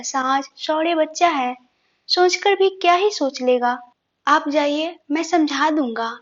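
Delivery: plain reading voice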